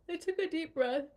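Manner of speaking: performed story line; acted-out read. Shown as a demonstration. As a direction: read it like you're stretching awake. Acting out the deep breath.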